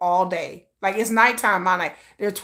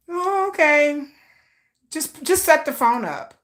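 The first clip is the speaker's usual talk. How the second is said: hesitant, awkward; 8 semitones higher, slower than usual. Retold or imitated. Awkward reply to boyfriend's demand.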